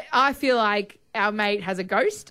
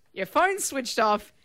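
ordinary talk, nothing marked

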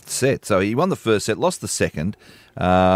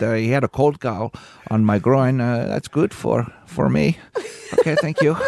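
mock Spanish accent, laughing; hammy Spanish-accented complaint. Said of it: "in an accent like that".

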